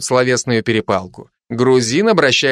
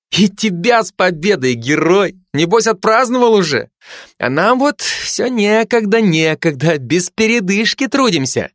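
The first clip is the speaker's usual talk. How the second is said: higher, lightly voiced flirtatious nurse; flirty nurse; 7 semitones higher than usual. Zinaida flirting with the lieutenant, lightly voiced flirty nurse. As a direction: tease the hero to catch his eye.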